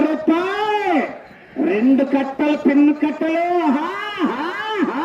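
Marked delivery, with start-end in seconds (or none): 3.8-5.0 spaced, rising "haa" cries; theatrical whooping for the crowd. Real, not acted. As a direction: stretch out each laugh-like "haa". Commentator's showy cries to crowd.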